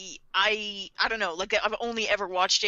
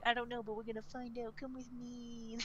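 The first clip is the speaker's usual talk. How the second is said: hushed, secretive; conspiratorial whisper; 3 semitones higher, 15 dB quieter than usual. Retold or imitated. L quietly pulling Light aside, whispering. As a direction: lean in and whisper the invitation.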